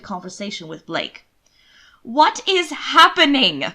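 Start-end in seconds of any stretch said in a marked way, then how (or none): 2.0-3.6 loud, slow, higher demand. Acted. Brother finally raising his voice at sister and Blake, loud. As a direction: make them stop and listen. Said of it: "reached a volume that made both of them look".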